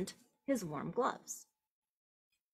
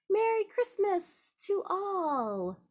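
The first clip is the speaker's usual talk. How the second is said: high, slow, ringing call; shouted greeting; 11 semitones higher, slower than usual. Acted. Farmer shouting a holiday greeting to all. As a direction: call it out joyfully to everyone. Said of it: "Then he shouted".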